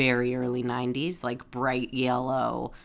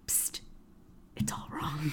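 hushed, whispered tip-off; imitated secret whisper; 14 dB quieter than usual. One person secretly warning Sam, whispering. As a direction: whisper it like a furtive informant.

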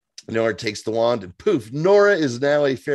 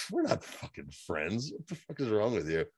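mock cold put-down; 10 dB quieter than usual. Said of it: "she's like".

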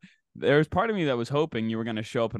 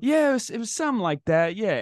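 faked agreement; 10 semitones higher than usual. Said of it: "And I'm like".

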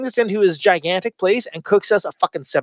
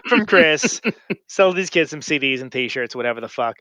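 ordinary talk

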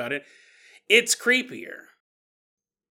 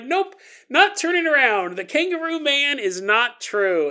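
much higher, loud nervous refusal; panicked denial; 8 semitones higher, 5 dB louder than usual. Acted. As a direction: refuse to look, scared. Scared denial at the boings.

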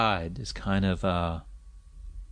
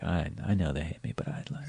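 mock whisper; 3 semitones lower, 7 dB quieter than usual. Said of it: "and you were just like".

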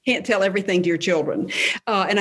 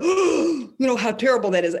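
shocked; 2 semitones higher than usual. Retold or imitated. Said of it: "try not to suck air and go".

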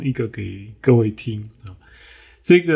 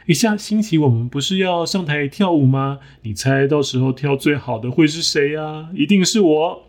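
higher, gloating show-off voice; bragging hen; 4 semitones higher than usual. Acted. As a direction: crow about winning. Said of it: "炫耀了自己跳舞会跳得最好".

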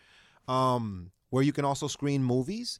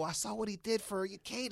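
ordinary delivery

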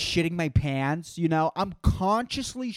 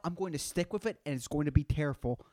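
ordinary delivery

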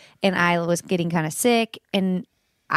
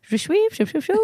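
a hint of French, pitched higher, mock French babble; mock French gibberish; 10 semitones higher than usual. Imitating French stranger to host, mock French babble. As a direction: parody a French stranger, lightly. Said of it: "what if someone was French".

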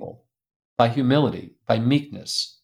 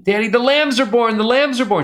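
high, loud joyful squeal; a little girl thrilled the lambs arrived; excited child's shout; 12 semitones higher, 4 dB louder than usual. Retold or imitated. Daughter running to her dad about the lambs, excited high shout.

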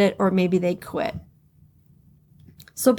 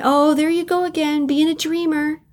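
higher, sing-song put-down of a dreamer; mock naysayer; 6 semitones higher than usual. Mimicking a friend who belittles her dreams, mocking.

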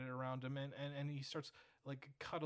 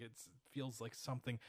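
usual speaking voice

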